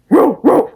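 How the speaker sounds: barking growl, high and loud; deep rasping growl. Shown as a demonstration. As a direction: bark it out like a dog. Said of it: "go like".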